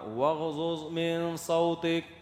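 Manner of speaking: slow chanted recitation of the verse; Quranic recitation. Acted. Preacher reciting a Quran verse to the congregation, slow chant. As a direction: recite it slowly, like tajweed.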